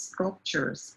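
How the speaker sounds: "sculptures" in two clear syllables, low and soft; two-syllable model. Shown as a demonstration. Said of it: "it's two syllables".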